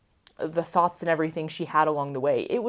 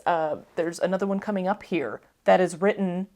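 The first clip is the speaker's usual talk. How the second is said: usual speaking voice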